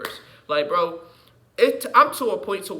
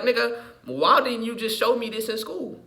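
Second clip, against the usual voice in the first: excited demand; 7 semitones higher than usual. Retold or imitated. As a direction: call them out, exasperated. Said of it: "I'm gonna be like".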